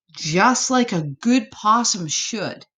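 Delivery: ordinary delivery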